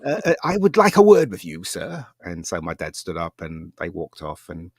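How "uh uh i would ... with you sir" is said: high, loud, pompous put-on voice; raised-pitch mock toff introducing himself; snooty man. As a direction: play a stuck-up official announcing himself. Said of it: "this really snooty guy".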